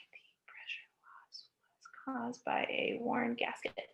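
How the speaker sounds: whispered under the breath, counting words. Shown as a demonstration. Teacher whispering the sentence to count its words. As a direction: murmur it to yourself while counting each word. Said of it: "Nine words".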